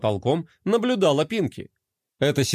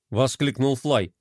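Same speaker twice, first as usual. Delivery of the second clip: plain reading voice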